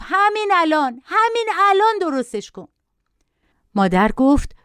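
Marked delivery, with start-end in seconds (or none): 0.0-2.7 put-on child's demand, a bit higher; a hint of a shouting child; mild mock yell. A narrator's reading. Child yelling at mother to fix it now, mild mock yell. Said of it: "بچه با فریاد گفت".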